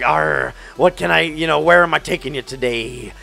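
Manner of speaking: a pirate tour guide greeting passengers. Retold or imitated. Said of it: "he basically sounded like a pirate".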